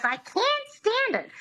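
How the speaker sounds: very high, grating "I can't stand it" with a flat nasal "can't"; the starlet parroting her coach badly; Lina Lamont squeak. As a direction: screech the line back like the starlet mangling it. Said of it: "And she goes".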